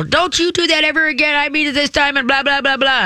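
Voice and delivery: very high mock scolding voice; high frustrated nagging. Frustrated woman lectures her dog in paragraphs, high nagging. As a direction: imitate a frustrated nag running on. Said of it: "they go higher and they start giving sentences".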